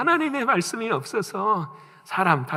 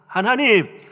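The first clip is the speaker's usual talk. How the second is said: loud, higher pleading protest building up; defiant complaint to God; 6 semitones higher, 5 dB louder than usual. Retold or imitated. Israelites protest to God, loud rising complaint. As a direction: talk back to God, rising to a cry. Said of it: "막 하나님 앞에 대들 때가 있었어요".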